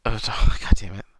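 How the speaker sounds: breathy laugh through the words; laughing whisper. Real, not acted. Groaning at the spoiler joke.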